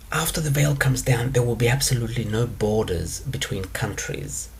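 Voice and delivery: ordinary talk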